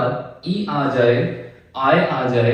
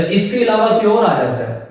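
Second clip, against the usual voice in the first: normal talking voice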